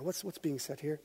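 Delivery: usual speaking voice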